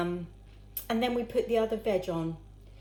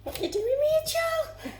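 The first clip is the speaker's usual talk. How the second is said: squeaky high mock-mum voice, much higher; imitating mum; 4 dB louder than usual. Mimicking mum scolding Rachel over the carrots, high squeaky voice. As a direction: tease her daughter in a high pitched put-on voice. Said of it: "She's gonna say".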